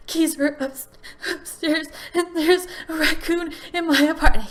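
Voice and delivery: high, tearful, choked-up words; sobbing out the explanation; crying. Retold or imitated. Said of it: "I can barely talk".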